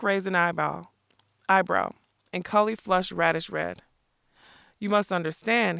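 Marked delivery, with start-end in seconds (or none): none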